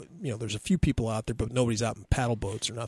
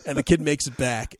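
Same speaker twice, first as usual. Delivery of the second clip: ordinary talk, nothing marked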